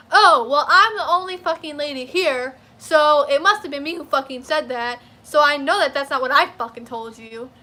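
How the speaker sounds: loud, sneering clerk voice; mock snotty clerk. Imitating the clerk insisting she said it, loud and snotty. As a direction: mimic her smug self-defence, loud. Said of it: "She was like".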